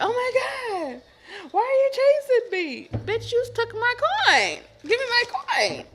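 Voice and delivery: high, loud squealing put-on voice; yelling at the coin thief in a squeaky voice; shrill mock yell. Acted character caught stealing, yelled at.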